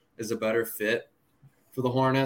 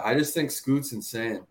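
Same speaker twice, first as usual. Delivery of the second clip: ordinary delivery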